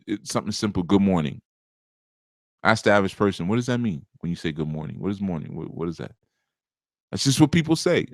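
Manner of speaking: normal talking voice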